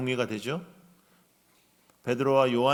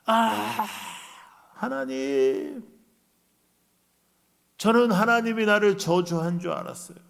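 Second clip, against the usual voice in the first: loud, high tearful thanks to God; joyful cry to God; 5 semitones higher, 5 dB louder than usual. Retold or imitated. Healed man crying out to God in tears, loud and high. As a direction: cry out to God overcome with joy. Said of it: "이렇게 소리를 질렀을 거예요".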